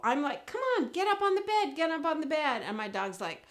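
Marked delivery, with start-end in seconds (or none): 0.5-2.6 high, fast pleading with a pet; eager coaxing. Acted. Owner calling dog up on the bed, quick eager coaxing.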